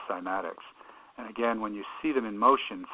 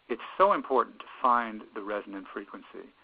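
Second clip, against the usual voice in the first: normal talking voice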